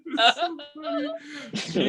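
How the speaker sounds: slow, high laugh-choked words. Real, not acted. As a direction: crack up while you say it. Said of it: "laughing our fucking asses off".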